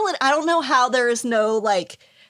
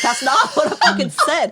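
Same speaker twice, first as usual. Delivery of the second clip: laughing mock Aussie; 3 semitones higher, 3 dB louder than usual. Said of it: "And they're like".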